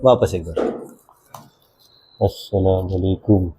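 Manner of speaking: teacher murmuring a greeting down a string phone; soft into string phone. Shown as a demonstration. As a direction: speak softly so only the listener hears. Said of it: "आराम से बोलो".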